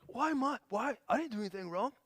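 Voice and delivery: high-pitched, half-hearted protest; mock yell. Husband justifying himself to his angry wife, mock yell. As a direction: imitate an indignant husband without real volume. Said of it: "I can yell and be like".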